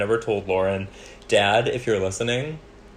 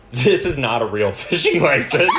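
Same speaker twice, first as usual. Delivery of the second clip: reading it out through laughter; laughing; 5 semitones higher, 6 dB louder than usual. Retold or imitated.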